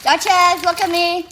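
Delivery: loud call to get the dog's attention. Real, not acted. Owner calling the dog to look at her, loud call. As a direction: call her name out loud so she looks.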